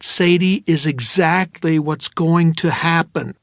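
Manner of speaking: plain reading voice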